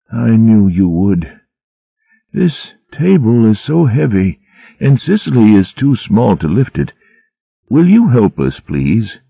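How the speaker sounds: put-on gently confused old mouse asking his friend; frail old abbot. A narrator's reading.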